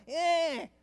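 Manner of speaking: short, high whining refusal; imitated toddler whine. Child rejecting the parent's guess, mock whine. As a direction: mimic a small child's wordless whine. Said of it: "whiny cry".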